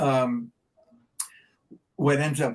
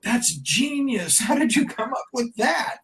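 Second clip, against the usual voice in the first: impressed amazement, acted; 10 semitones higher than usual. Record company hearing the band's new name, acted amazement. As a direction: imitate someone bowled over by an idea.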